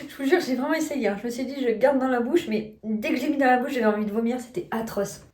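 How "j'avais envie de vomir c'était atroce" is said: ordinary talk, nothing marked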